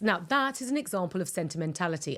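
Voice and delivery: normal talking voice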